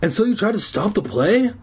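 high-pitched accusing question; indignant boy confronting someone; demanding. Acted. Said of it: "Zeke demanded".

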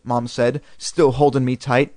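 ordinary delivery, nothing marked

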